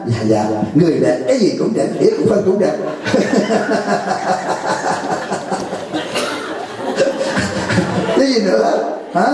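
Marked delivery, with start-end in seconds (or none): 2.8-8.1 high airy laugh without voice; real giggle at the punchline; laughing silently at the joke; breathless laugh. Real, not acted. Teller cracks up at own story.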